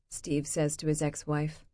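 ordinary delivery

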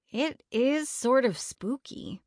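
slow. A narrator's reading. Melody admits to her friends it's odd, slowly. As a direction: draw it out, admitting it reluctantly. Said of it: "Melody said slowly".

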